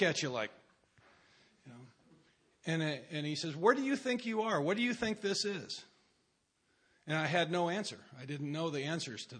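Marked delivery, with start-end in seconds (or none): 3.6-5.8 stern rebuke. Retold or imitated. Tony confronting the newcomer.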